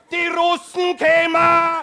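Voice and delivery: high, loud, slow alarm cry; terrified old resident shouting; panicked old man shout. Acted. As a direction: yell as if the Russians are coming.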